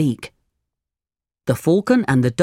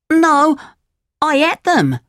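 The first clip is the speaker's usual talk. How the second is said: fibbing puppet yelp; 13 semitones higher than usual. Acted. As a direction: blurt the lie out high. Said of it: "said Pinocchio".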